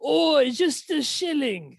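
high, slow mock-cockney ask; hammed-up London accent. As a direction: stretch it like a Dickens urchin. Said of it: "doing it British".

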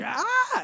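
high shriek held back in volume; restrained mock shriek; imitated banshee shriek. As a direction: mimic a small boy's banshee scream. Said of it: "a scream that sounds like a banshee".

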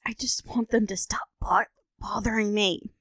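unvoiced, tired whisper; whispered complaint about family; whispered whine. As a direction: whisper it like you're done with it. [name] whining about family.

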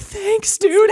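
very high-pitched little thank-you; meek thanks. Acted. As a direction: squeak out your gratitude. Meek thanks for free tea.

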